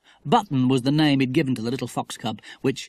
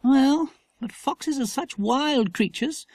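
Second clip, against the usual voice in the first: slow, doubting mother, pitched high; a mother's gentle doubt, drawn out slow; doubtful; 12 semitones higher, slower than usual. A narrator's reading. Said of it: "said his mother doubtfully".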